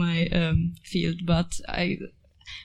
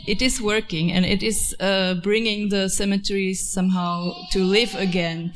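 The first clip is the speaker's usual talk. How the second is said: ordinary talk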